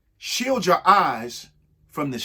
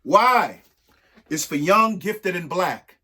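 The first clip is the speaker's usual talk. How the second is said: rhythmic, lilting read-aloud of a rhyming book; reading to children. Acted. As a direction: read each verse to the kids with a bounce.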